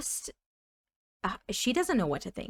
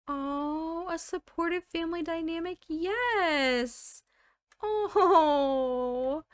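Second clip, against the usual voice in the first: high, gushing coo; delighted; 9 semitones higher, slower than usual. Real, not acted. Player pleased by supportive family dynamic, delighted.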